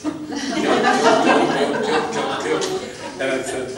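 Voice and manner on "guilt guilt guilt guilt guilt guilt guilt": loud, laughing, repeated word; laughing repetition. Real, not acted. Mocking his family's guilt construct, laughing loudly. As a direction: cackle out each guilt.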